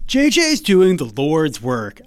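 put-on slow, laid-back Californian accent on the quote. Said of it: "I'm making him sound Californian".

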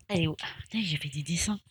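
whispered aside to herself; whispering. Real, not acted. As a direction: breathe it out under her breath. Muttered aside while drawing. (chuchoté)

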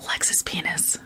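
soft secret whisper. Pretend. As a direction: whisper it teasingly. Whispered call and response.

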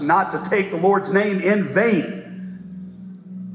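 slow, weighty recitation of "the Lord's name"; solemn slow quote. Acted. Pastor to congregation, reciting the commandment, slow and grave.

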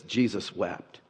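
usual speaking voice

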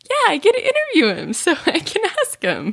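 high-pitched gleeful excitement with a laugh; retold giddy delight, voice leaping up; excited fan. Interviewer recalls getting to interview him, giddy and high. Retells own glee before interview.

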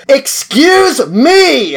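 a slow, high, loud call of "Excuse me!". Retold or imitated. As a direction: bellow it slowly to get them to move. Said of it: "eventually I just had to go".

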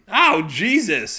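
loud, high, drawn-out yelp; pained shout. Acted. Revived man yelling at the one who tore it, loud yelp. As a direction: yelp as the tape rips off. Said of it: "he shouted".

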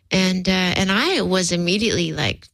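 normal talking voice

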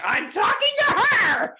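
high, slow, loud retold shout; big hammed-up drunken yell; acted shout. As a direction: yell it to cut off an intruder. Retells confronting creepy man, shouting.

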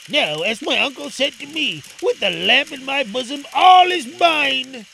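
put-on high, loud exulting boy; triumphant Aladdin.

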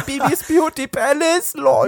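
high, slow gushing fan; high, slow fangirl voice; gushing [name]. Pretend. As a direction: swoon over a beauty influencer.

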